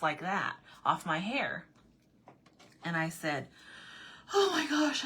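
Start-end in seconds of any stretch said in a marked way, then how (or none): 4.3-5.0 high-pitched gush; high, flustered re-enacted exclamation; retold flustered relief. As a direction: let the panic spill out in relief. Retold relief after the panic.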